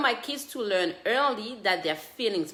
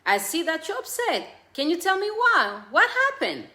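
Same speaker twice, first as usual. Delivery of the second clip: higher, louder caring voice for a little one; gentle child-directed voice; 7 semitones higher, 3 dB louder than usual. Shown as a demonstration. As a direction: coax the child gently upward. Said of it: "Instead, say".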